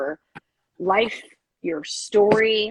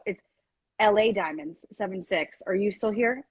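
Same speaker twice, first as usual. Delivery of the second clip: normal talking voice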